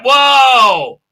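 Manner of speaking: loud high whoa; mock crowd gasp. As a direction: shout it like the whole room is stunned. Crowd reacts to the shot.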